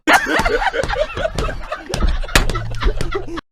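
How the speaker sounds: long, high-pitched laughing fit; high cackling laughter. Real, not acted. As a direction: break into a high, drawn-out laugh. Laughs after his shouted reaction.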